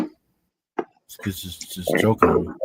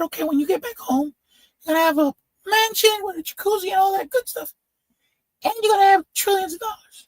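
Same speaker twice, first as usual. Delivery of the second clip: high-pitched little guy. Retold or imitated. Tiny-headed man quoting the mermaid in his high squeak. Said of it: "The guy has a really high-pitched voice".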